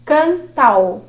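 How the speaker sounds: "cantal" said as one run-together word, canta fused with a; "cantal" pronunciado juntando as sílabas; merged syllables. Shown as a demonstration. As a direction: join "cantal" into a single beat. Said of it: "Tá?".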